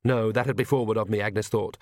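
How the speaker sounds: plain reading voice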